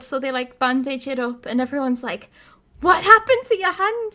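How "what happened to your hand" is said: high, loud retold question; mock shock. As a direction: act out everyone's alarmed question. Acts out everyone's shocked question.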